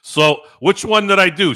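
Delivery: ordinary delivery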